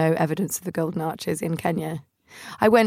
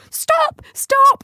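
high, loud shrieked stop; panicked yell; 8 dB louder than usual. Retold or imitated. Sister squatting yells at the van, high panicked shriek.

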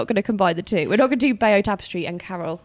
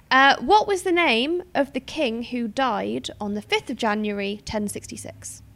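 usual speaking voice

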